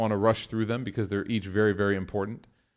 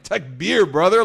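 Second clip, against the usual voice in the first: excited takbir; 7 semitones higher, 9 dB louder, slower than usual. Retold or imitated. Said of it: "I'm like".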